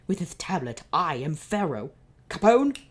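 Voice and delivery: loud gloating declaration; triumphant proclamation. Acted. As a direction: proclaim it, then call out. Villain proclaims, calls henchman.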